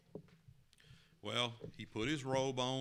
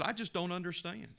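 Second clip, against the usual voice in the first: higher, pleading cry of confusion; hurt man pleading with Jesus; 9 semitones higher than usual. Acted. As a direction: plead it up high. Young man's second complaint.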